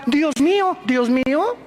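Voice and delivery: high, imitating atheists crying "Dios mío"; mock frightened cry. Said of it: "ateos que gritaban". (imitando a los ateos que gritan "Dios mío" con miedo)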